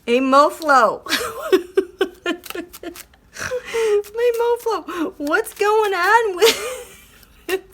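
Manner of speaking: cracking up while asking Mo; high laughing squeal of a greeting; giggly high address. Real, not acted.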